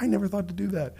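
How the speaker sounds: high-pitched marvelling aside; amazed. Retold or imitated. Pupil astounded by teacher's piano tricks, amazed. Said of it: "totally rock my world".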